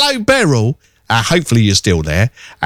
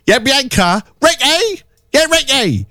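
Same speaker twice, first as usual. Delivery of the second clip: high-pitched mock yell of a name; mock soap character call; 13 semitones higher, slower than usual. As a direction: call out names in a high, hammed-up voice. Mimics soap character shouting names.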